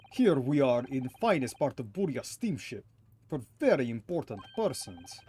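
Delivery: put-on huffy Russian-accented sailor. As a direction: play a stubborn Russian crewman. Voices Russian sailor character.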